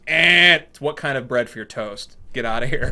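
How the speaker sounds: scoffing, loud mock correction; a loud scoff at a new waitress; mocking boss. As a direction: sneer the right answer back at her. Boss mocking wrong answer.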